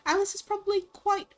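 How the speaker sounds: shaky-quality amateur British accent; hammed-up British, drifting toward Australian. Shown as a demonstration. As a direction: put on a posh-ish British voice that slips. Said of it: "of the British voice".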